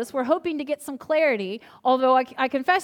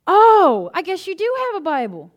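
much higher, loud startled "Oh" then laughing; surprised realization; 7 semitones higher, 10 dB louder than usual. Retold or imitated. Neighbor girl learns the speaker is a pastor, loud surprise. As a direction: caught off guard, then sheepishly amused.

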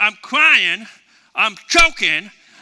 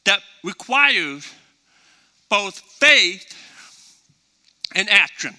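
usual speaking voice

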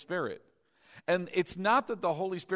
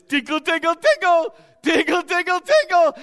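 high, loud tickle-tickle voice; excited, sing-out tickle teasing; playful tickling; 11 semitones higher, 12 dB louder than usual. Acted. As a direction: squeal it like tickling a baby. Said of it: "That's like God going like this".

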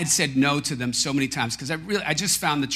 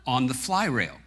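ordinary talk

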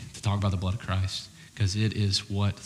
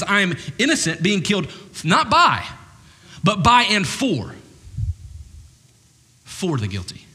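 building emphasis; 8 semitones higher, 4 dB louder than usual. Acted. Voicing Jesus' blood, building.

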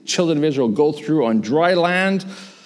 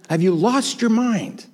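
higher-pitched disbelief, falling at the end; emphatic incredulity; 8 semitones higher than usual. Acted. Preacher voicing shocked onlookers, more emphatically incredulous. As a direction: hit it harder, as astonished disbelief. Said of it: "we're all a little incredulous".

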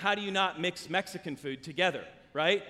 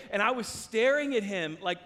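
usual speaking voice

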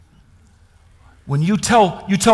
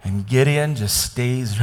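usual speaking voice

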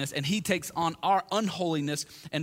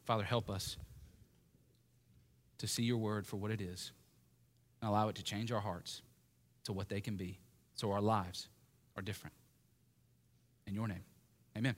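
low, soft and unhurried prayer; 7 semitones lower, 8 dB quieter than usual. Real, not acted. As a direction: pray quietly to the Father, leaving pauses. Closes a reading in prayer.

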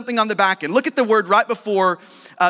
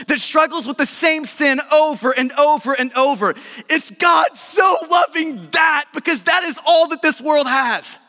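full-force preaching; 4 semitones higher than usual. Acted. Pastor urging the congregation, full-force preaching. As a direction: preach it with rising fervour.

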